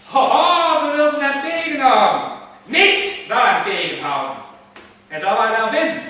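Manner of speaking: high, loud, defiant calling out; defiant cry. Acted. Gentleman defying his imagined pursuers, loud and high. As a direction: shout down the ones holding you back.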